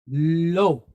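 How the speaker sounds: "lo" sounded out alone, low; syllable demonstration. Shown as a demonstration. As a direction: say "lo" as one isolated teaching syllable, pitched low. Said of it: "we have lamed pointed cholem".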